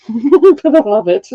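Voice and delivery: giggling through it; laughing warmly about the shirt. Real, not acted.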